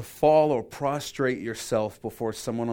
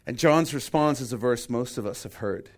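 ordinary delivery

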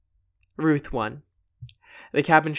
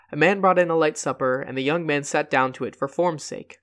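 plain reading voice

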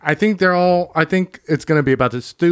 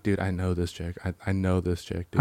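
low, much softer aside; retold hushed thought; 9 semitones lower, 11 dB quieter than usual. Friend retelling his thought on spotting a familiar girl, hushed. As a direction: say it under your breath as a private thought.

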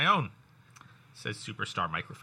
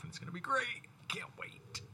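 whispered glee; 14 semitones higher, 8 dB quieter than usual. Acted. Gleeful evil plan.

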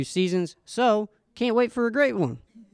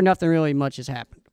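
normal talking voice

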